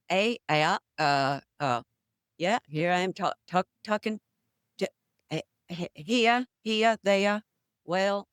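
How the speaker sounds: New England accent with dropped R's. Shown as a demonstration. Actor showing how Our Town sounded, New England accent. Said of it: "was a New England accent in Our Town".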